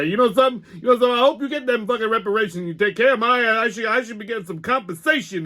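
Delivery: high, cocky puppet voice, indignant; a brash puppet arguing about reparations; Pop the puppet. Acted. Brash puppet arguing with host, high and indignant.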